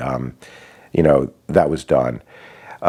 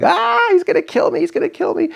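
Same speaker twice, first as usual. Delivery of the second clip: much higher, strained imitation of a terrified scream; imitating a hysterical high-pitched shriek; mock panicked scream. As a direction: ham up the wife's shriek that he will kill her. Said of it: "my wife starts screaming".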